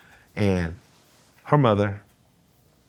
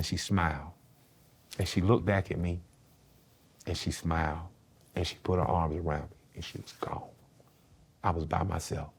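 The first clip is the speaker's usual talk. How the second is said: hushed; 4 semitones lower, 9 dB quieter than usual. Real, not acted. Grandmother's death, hushed.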